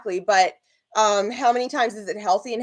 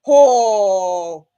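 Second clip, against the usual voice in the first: loud, drawn-out "oh"; acted outcry; 8 dB louder than usual. Imagining shooting at the birds, a loud outcry. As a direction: let a long cry burst out and fade.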